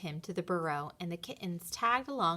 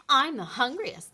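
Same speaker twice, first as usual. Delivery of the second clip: arguing; 5 semitones higher, 5 dB louder than usual. A narrator's reading. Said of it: "Lottie argued".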